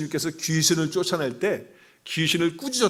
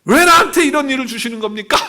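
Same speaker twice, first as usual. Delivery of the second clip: loud, high wailing protest; hammed-up indignant grievance; complaining to God; 11 semitones higher, 10 dB louder than usual. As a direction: cry out an aggrieved complaint. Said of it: "이렇게 컴플레인".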